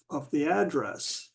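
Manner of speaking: ordinary talk, nothing marked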